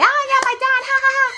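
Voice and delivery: high, smug sing-song giggle; fake sweet laugh. Smiling at dad after wishing him dead, high fake sweetness. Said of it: "smiling, and I'm like".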